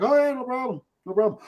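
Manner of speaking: high, offhand mock approval; acted easygoing okay; breezy approval. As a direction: wave it through in a high voice. Voices people okaying some accents.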